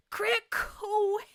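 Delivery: very high, cracking squeal of delight. Real, not acted. Streamer to chat, overjoyed by a pun name, squealing high.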